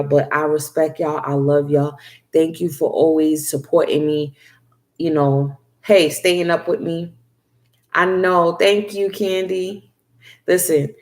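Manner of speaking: ordinary talk, nothing marked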